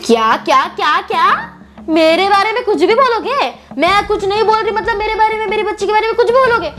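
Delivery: loud, heated challenge; angry confrontation. Acted. The other woman confronts them for insulting her, loud and angry. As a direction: she squares up, loud and furious.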